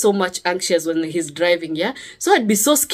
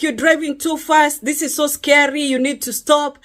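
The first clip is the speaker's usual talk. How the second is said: anxious nagging; 6 semitones higher than usual. Retold or imitated. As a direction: nag him nervously from the passenger seat. Nagging husband about fast driving.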